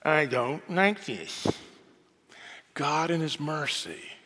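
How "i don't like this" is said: slow, pouting mumble of a sulking prophet; sulky Jonah. Acted. Preacher voicing Jonah sulking on the hilltop, slow pout. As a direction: drag it out like a grumpy sulk. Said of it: "he sulks".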